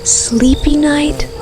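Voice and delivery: usual speaking voice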